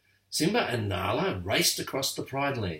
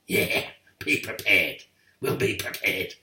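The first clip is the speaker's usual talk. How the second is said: rough, breathy hyena voices echoing the plan; sneering hyena chorus, half whispered; dim-witted hyenas parroting; cackling hyenas; 3 semitones lower than usual. A narrator's reading. Hyenas voiced laughing along.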